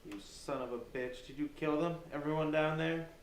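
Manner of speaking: rising fury, voice climbing up; angry accusation. Acted. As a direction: demand answers, outraged. Captive confronts his captor.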